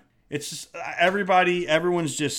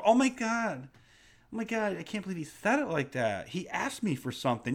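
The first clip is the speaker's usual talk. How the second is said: hushed, scandalized imitation; put-on wounded disbelief; mock offended server; 2 semitones higher, 6 dB quieter than usual. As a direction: play an appalled waiter, softly.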